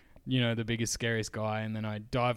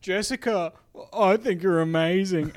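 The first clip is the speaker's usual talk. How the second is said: Copeman impression; 6 semitones higher, 5 dB louder than usual.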